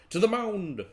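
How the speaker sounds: put-on loud, high drunken call; rallying cry. Drinker orders his buddy to the pitch, rallying cry. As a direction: call out like a sloshed commander.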